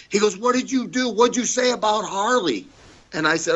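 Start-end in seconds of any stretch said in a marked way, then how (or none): 0.4-2.7 much higher-pitched accusing demand; high, insistent mock outrage; retold angry interrogation. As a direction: imitate a pissed-off boss grilling someone. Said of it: "He's pissed".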